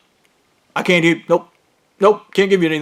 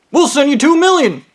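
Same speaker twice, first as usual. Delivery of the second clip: high, eager offer; eager caller; 10 semitones higher, 4 dB louder than usual. Retold or imitated. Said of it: "And he's".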